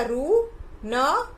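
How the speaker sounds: stretched syllable by syllable; slow syllables. Shown as a demonstration. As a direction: sound out each syllable slowly. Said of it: "तो क्या बोलेगा?".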